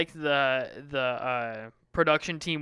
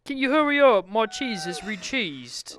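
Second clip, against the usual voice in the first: high, impatient put-on British voice; mock British accent; 5 semitones higher, 3 dB louder than usual. Imitating the sandwich guy rushing the interviewer, high British accent. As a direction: whine it in broad mock British, slowing at the end. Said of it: "making fun of accents".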